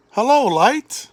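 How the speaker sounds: bright, high, loud call of creation; God calling light into being with delight; joyful greeting. A narrator's reading.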